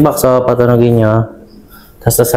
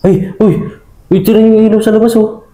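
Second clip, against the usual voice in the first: excited; 11 semitones higher than usual. Real, not acted. Ghost hunters spot outside light.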